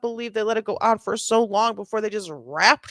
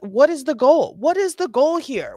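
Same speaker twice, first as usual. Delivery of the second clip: ordinary talk, nothing marked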